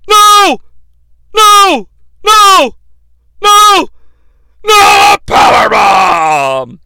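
high, loud repeated shouts; Vince McMahon yell. Retold or imitated. Announcer horrified by the power bomb, shouting no. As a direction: scream in disbelief. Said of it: "Vince McMahon on the call".

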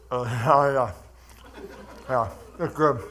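chewing and savoring; mouth full. Real, not acted. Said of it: "talking with my mouth full".

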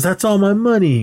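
high-pitched retold claim; mock indignant objection; acted protest. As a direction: voice pitched well up on "That's all my money". Voices Chosen claiming the wallet cash.

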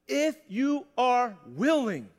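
IF YOU ARE WILLING at half speed, every word set apart; very slow, measured emphasis on each word; slow, deliberate repeat. Shown as a demonstration. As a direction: draw out each word of "willing" line for weight. Repeats verse slowly for emphasis.

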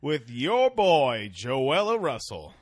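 loud, slow hype-man voice; announcer intro. Pretend. Host introducing guest Joella, loud slow announcer drawl. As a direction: stretch out the guest's name like an emcee.

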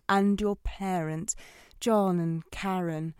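ordinary talk, nothing marked

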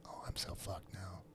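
breathless whisper to himself; whispered thought. Retold or imitated. Realizing he offended the guy, whispered to himself. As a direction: whisper it like a private panic. Inner thought after awkward question.

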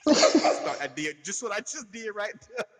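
high laughing speech; words riding a laugh. Real, not acted. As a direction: talk through laughter. Laughing retelling.

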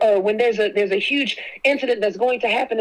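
ordinary talk, nothing marked

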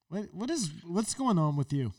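usual speaking voice

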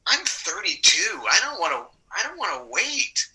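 retold whine to himself, dragging out the words; impatient inner protest. Actor thinking about the long career path, mock impatient protest. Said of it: "I remember thinking to myself".

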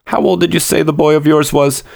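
high-pitched, curious clerk. A narrator's reading. Clerk asking customer the boy's age, high and curious. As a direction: ask high and probing, puzzled. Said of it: "demanded the clerk curiously".

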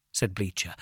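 usual speaking voice

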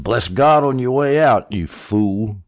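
sarcastically voiced scornful wife; sarcastic. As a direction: throw it out as a sarcastic send-off. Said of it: "been sarcastically saying".

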